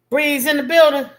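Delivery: like a friend just walked in; excited shout-out. Real, not acted. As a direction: call out the new arrival with joy. Greeting a viewer joining the stream.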